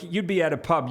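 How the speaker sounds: ordinary delivery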